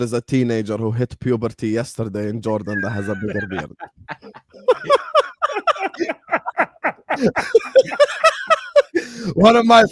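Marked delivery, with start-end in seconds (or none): 4.7-9.3 big high cackle of laughter; long loud laugh. Real, not acted. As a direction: burst out laughing at him. Mocking friend's beard.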